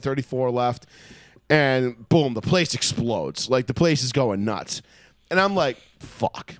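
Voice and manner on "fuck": muttered curse. Retold or imitated. Realizing the Patriots just scored.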